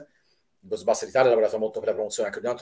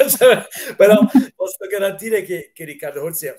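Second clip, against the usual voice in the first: higher, louder, talking through laughter; laughing; 9 semitones higher, 8 dB louder than usual. Real, not acted. Vouching for colleague's special effects on a live stream, laughing. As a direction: break into a laugh and keep talking.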